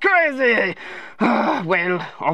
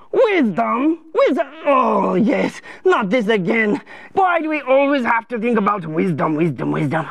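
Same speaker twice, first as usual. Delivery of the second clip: indignant, dragged-out complaint; whiny royal protest; exasperated king; 2 semitones lower than usual. Acted. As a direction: groan at being lectured. King balks at the advice.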